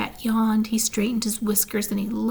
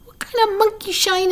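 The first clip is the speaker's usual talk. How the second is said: a touch of a grumpy rat; growling rat; 11 semitones higher than usual. A narrator's reading. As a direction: grumble it, rudely woken. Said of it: "he growled".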